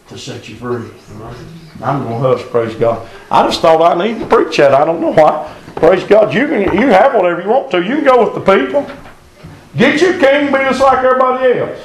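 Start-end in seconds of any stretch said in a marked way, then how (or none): 3.3-11.8 rising, louder preacher's cry; preaching at full force. Real, not acted. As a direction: build the sermon to a climax.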